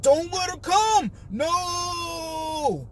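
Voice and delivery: high, loud mock-frantic shout; frantic mock panic. Retelling cast's panic at Jen coming, high frantic mock shout. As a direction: shriek it like a panicked guest. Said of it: "everyone's like".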